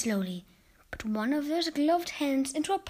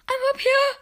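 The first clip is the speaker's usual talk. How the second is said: high, loud call from up a tree; boy shouts down to grandma from the tree; 13 semitones higher, 6 dB louder than usual. Acted. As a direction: call down from the treetop. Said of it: "I shouted back".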